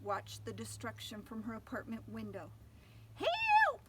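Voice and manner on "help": very high, loud panicked cry; terrified engineer screams for help. Acted. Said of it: "cried the engineer".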